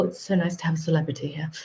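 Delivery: ordinary delivery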